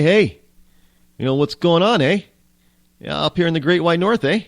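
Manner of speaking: mock Canadian accent. Mocking how Canadians supposedly talk, in an exaggerated Canadian accent. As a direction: play a cartoon Canadian with a Canadian accent, drawing out every eh. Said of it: "they all don't talk like that".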